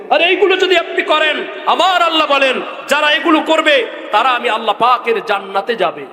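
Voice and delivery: high sermon cry, settling toward the end; impassioned preaching. Real, not acted. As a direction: call the promise out high, then come down. (চড়া গলায় ওয়াজ)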